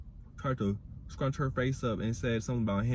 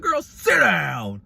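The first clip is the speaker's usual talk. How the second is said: firm loud command; 5 semitones higher, 9 dB louder, much slower than usual. Retold or imitated. Telling Jayla to calm down.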